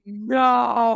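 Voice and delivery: retold howl of protest; drawn-out dismay. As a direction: stretch NO out in mock despair. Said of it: "I was like".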